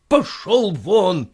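slow, drawn-out angry yell; angry shout. Acted. Master throws the boy out, angry. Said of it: "закричал он".

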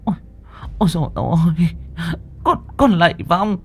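high-pitched wailing plea to a spirit; frightened pleading. Acted. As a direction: beg the ghost in a panicked high voice. Terrified women bow to the spirit. (van lạy hoảng sợ, giọng the thé)

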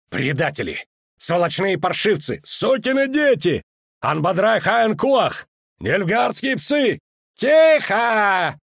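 shouted high curses, building to a roar; furious shouting. A narrator's reading. Angry elves shouting at traitors. (яростный крик)

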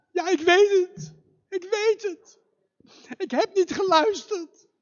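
put-on a boy admitting his mistake; high childlike voice, guilty and meek; boy's voice. Boy answers fox, ashamed.

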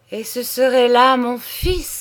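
exclamation indignée, plus haute et plus forte; lance-le comme un cri d'incrédulité; s'écrie indigné. A narrator's reading. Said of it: "s'écria-t-il". (le comte s'écrie, outré par son fils)

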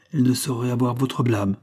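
plain reading voice

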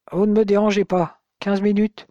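a blunt farmer cutting the call short; the gruff father, brusque and loud on the phone. A narrator's reading. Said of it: "Le père de Fred était assez bourru".

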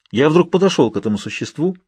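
usual speaking voice

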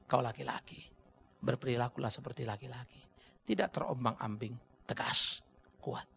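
low soft earnest exhortation; solemn charge. Real, not acted. Preacher guiding husbands to declare to themselves, low solemn. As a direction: speak it quietly as a vow.

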